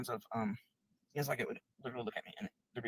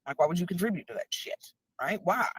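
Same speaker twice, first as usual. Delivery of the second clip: higher, louder furious quote; retold angry outburst; 6 semitones higher, 7 dB louder than usual. Telling off her ex, reliving an angry yell. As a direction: snap back at them in disgust. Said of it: "And I'm like".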